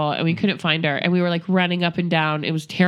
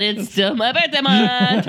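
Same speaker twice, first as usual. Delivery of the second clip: mock insistence; slower than usual. Birthday girl pressing the store clerk, mock insistence. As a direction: insist on it, stretching the words.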